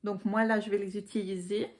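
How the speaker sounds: ordinary talk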